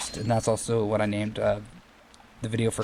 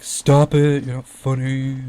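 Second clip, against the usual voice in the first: louder, higher put-on whiny voice; Nintendo Capri Sun impression; 3 semitones higher, 6 dB louder, much slower than usual. Gamer mimicking a Nintendo Capri Sun ad line, higher and louder. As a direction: copy the Capri Sun character's scolding. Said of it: "Just copying Nintendo Capri Sun".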